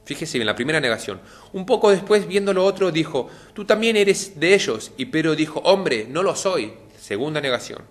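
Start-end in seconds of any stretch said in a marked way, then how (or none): 1.5-6.7 loud, high, fervent Bible reading; preacher's dramatic scripture voice. Acted. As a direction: proclaim the scene with rising fervour.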